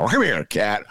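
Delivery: ordinary talk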